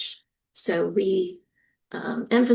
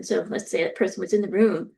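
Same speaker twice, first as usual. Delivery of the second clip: ordinary talk, nothing marked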